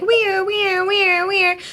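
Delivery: high, loud siren wail on we're; ambulance siren. Shown as a demonstration. Host shows listeners the trick for we're, high siren voice.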